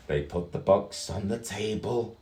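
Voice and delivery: plain reading voice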